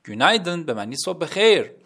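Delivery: normal talking voice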